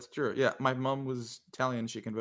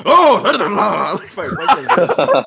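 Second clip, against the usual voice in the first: mock high squeaky Tamir voice, much higher and louder, laughing through it; Tamir imitation; 9 semitones higher, 18 dB louder, much slower than usual. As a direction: squeal it out like a giddy caricature, cracking up. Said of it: "he sounds like this".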